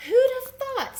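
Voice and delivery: high, loud, amazed; high amazed pig. A narrator's reading. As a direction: squeal it like an astonished pig. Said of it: "said Pig".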